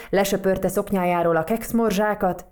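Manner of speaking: ordinary delivery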